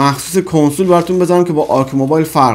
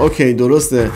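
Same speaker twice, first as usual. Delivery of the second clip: normal talking voice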